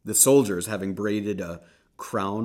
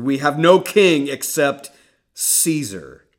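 loud, much higher declaration; defiant shout; 7 semitones higher, 14 dB louder than usual. Acted. High priest answering Pilate, loud defiance. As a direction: fling it back defiantly.

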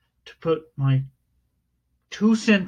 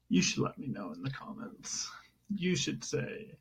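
much softer, low confidential whisper; hushed whisper; 4 semitones lower, 15 dB quieter than usual. Pretend. Host asking listeners for comments, whispered. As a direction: drop right down and whisper it to the listeners.

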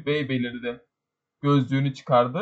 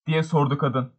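ordinary delivery

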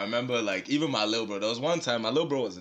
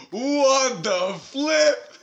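drawn-out shocked exclamation, higher and louder; 11 semitones higher, 5 dB louder, much slower than usual. Retold or imitated. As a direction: stretch it out in disbelief. Shocked at brother's note.

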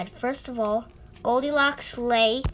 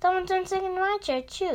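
much higher, fast mother bear voice; mother bear exclaiming; 8 semitones higher, faster than usual. A narrator's reading. Mother bear finds her chair sat in, high exclaiming. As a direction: squeak it out like a startled mother bear. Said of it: "exclaimed the mother bear".

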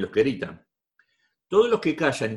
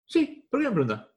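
another woman's reply; 9 semitones higher than usual. Retold or imitated. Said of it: "Otra me diría".

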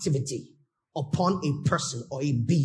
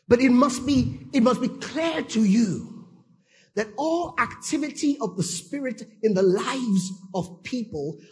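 loud, higher, driving sermon voice; full-force preaching; 5 semitones higher, 5 dB louder than usual. Acted. Preacher rousing his congregation, loud and fervent.

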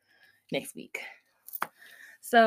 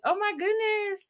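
high gushing excitement; excited squeal; 10 semitones higher than usual. Retold or imitated. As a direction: squeal it like a thrilled kid. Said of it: "Ray was gonna be like".

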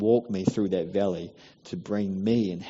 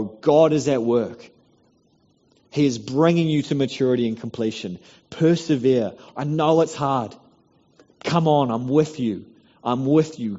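urgent encouragement; 4 semitones higher, 5 dB louder than usual. Retold or imitated. Voicing brothers gripping a believer.

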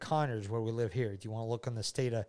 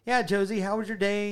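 raised, lively small-talk question; chatty small talk; 8 semitones higher, 6 dB louder than usual. Shown as a demonstration. Dad demonstrates small talk with Josie, chatty and lively. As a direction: toss out a chatty question, high and loud. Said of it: "where you're talking like this".

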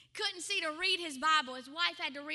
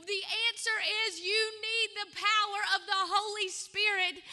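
rising revival preaching; fervent preaching; 4 semitones higher, 3 dB louder than usual. Acted. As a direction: drive the appeal home to the crowd. Preacher's altar-call climax.

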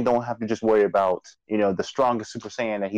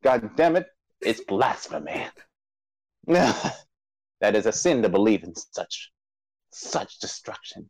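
mock preacher voice. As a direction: condemn the sin in solemn mock outrage, fading away. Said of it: "put your preacher voice on".

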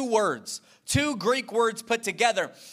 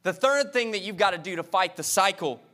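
normal talking voice

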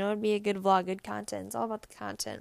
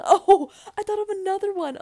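high, loud burst of excitement; sudden excited realisation; 11 semitones higher, 10 dB louder than usual. Real, not acted. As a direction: blurt it out as it hits you. Suddenly remembers another funny commercial.

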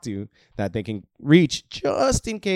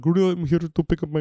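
garbled customer mimicry; 6 semitones higher than usual. Mimics struggling customer's garbled request.

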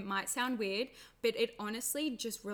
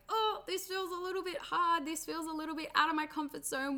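much higher, small worried inner voice; scared inner voice; 7 semitones higher than usual. Acted. The frightened part of you speaking up, high and worried. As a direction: play the nervous little part of you. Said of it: "there's a part of you that's like".

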